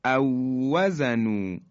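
او وزنو chanted slowly with one doubled واو; merged recitation. Shown as a demonstration. Teacher demonstrating the merge to students, slow chant. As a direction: join the two واو into one strong واو.

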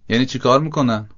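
usual speaking voice

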